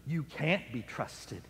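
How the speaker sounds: Satan's voice hissing doubt at Paul, hushed; inner whisper. Acted. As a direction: breathe it like a creeping doubt. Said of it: "started whispering and saying to him".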